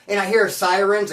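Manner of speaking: normal talking voice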